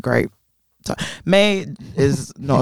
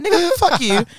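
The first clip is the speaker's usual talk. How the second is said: petty defiance. Retold or imitated. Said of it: "I'm like".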